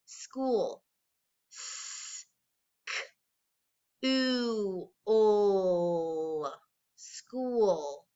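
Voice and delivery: "School" then "S, k, oo, o" one sound at a time, S whispered. Shown as a demonstration. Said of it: "Let's try it again".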